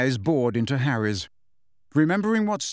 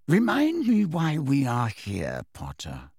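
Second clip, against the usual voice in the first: Snape voice; slower than usual. A narrator's reading.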